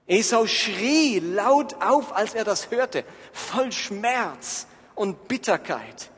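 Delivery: laut und langsam, Schrei mitgespielt; dramatisch erzählt. Acted. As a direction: den Schmerz hinausrufen, gedehnt. Narrated cry of Esau. (Prediger lässt Esaus Aufschrei in der Erzählung hören, laut und gedehnt)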